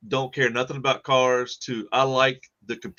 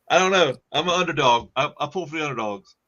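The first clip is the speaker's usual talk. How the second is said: ordinary talk, nothing marked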